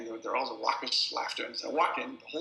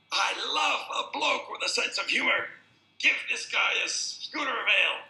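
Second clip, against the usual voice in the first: much higher, loud boisterous bloke; hearty shout; 11 semitones higher, 6 dB louder than usual. Retold or imitated. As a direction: bellow it across the pub, delighted. Drunk roars approval at the bar.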